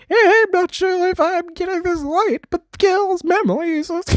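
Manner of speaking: squeaky exaggerated granny voice; shrill mock old lady, very high and loud. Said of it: "I should do, like, a".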